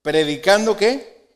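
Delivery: ordinary delivery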